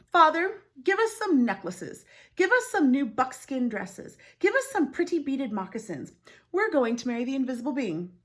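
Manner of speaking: loud, high, bossy demand; spoiled demanding daughters. Acted. Daughters demanding gifts from their father, high and bossy. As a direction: whine and order like spoiled girls.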